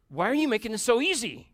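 high, loud, exasperated yell at dad; angry shout. Retold or imitated. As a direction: let the frustration burst out loud. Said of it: "And I got mad".

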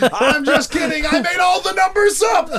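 cackling confession, high and loud; laughing. Real, not acted. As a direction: burst out laughing mid-sentence. Confessing the prank, cracking up.

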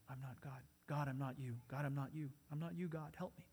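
soft, low, private plea; hushed prayer, fading almost away; quiet prayer. Shown as a demonstration. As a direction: murmur it to God under your breath. Models the simple prayer to God.

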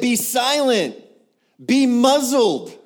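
high, loud shouted command; booming order, stretched out; forceful rebuke. Acted. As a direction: silence a demon with full authority. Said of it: "That's what he did. He said".

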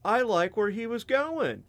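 high-pitched put-on voice of an impressed elder; approving elder. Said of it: "they're like".

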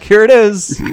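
big, loud, high-pitched presentation; triumphant reveal. Acted. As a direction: shout it like unveiling a masterpiece. Director returns with finished masterpiece.

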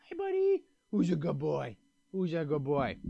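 soft cooing pet talk; vet talking to pet. Real, not acted. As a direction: coo softly to the puppy like a vet. Greeting his puppy.

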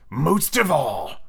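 very slow, drawn-out villain; grand pirate captain; passionate Hook. Acted. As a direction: stretch it out with passion. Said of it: "Hook was saying passionately".

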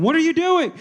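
high-pitched, startled exclamation; mock frightened outburst. A narrator's reading. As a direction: act out a startled bride's cry. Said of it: "'Cause she was afraid".

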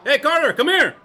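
loud high call across the room. Acted. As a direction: shout for him to come. Calling teammate over.

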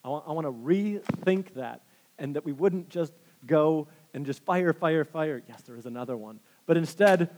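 ordinary talk

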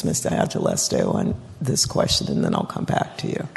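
rasping out the last words with almost no voice; losing her voice. Real, not acted. Council president calling on a member, voice nearly gone. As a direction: voice dropping to a raspy whisper. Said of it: "I'm losing my voice here".

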